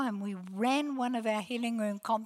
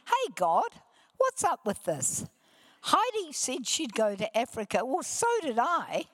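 higher-pitched playful protest, climbing at the end; mock complaint; 6 semitones higher, 3 dB louder than usual. Preacher retells complaining to God about Africa, mock indignant protest. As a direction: take it up with God, half in jest.

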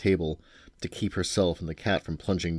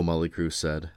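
usual speaking voice

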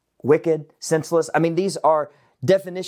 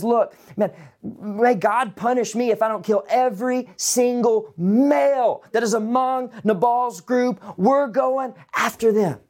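mock vow of vengeance; 6 semitones higher than usual. David vowing to kill, enraged.

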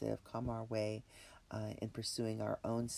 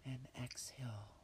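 breathy whisper, calm and unhurried; guided meditation; 6 dB quieter than usual. Acted. Leads a relaxation meditation.

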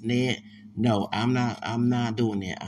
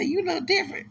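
much higher mock-surprised line; quoting himself. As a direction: lift the voice high as if taken aback. His own reply quoted.

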